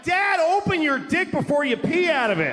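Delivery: high-pitched retold yell at dad; exasperated yell. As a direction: holler it up high, like a kid pleading with dad. Exasperated child yelling at dad.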